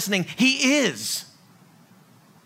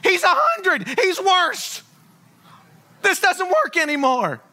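lightly voiced scoffing old woman; a hint of sarcastic elderly Sarah; 3 semitones higher, slower than usual. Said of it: "And she's like".

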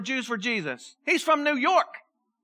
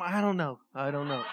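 quieter and lower, evasive mumble; sheepish dodge; 8 semitones lower, 7 dB quieter than usual. Retold or imitated. Pastor recalling hiding his Pennsylvania roots when asked where he was from, mumbling. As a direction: duck the question, mumble it. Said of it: "Where are you from?".

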